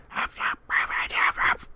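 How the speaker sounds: imitated huffing stage whisper; weird whisper. Friend mimicking a wrestler's odd whisper to a partner, weird huffed whisper. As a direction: huff it out in a whisper, without voice. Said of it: "he does this weird, like, whisper too".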